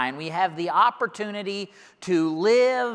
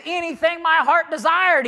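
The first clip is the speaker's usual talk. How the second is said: emphatic boast; 10 semitones higher, 4 dB louder than usual. Acted. Preacher voicing Solomon's boasting.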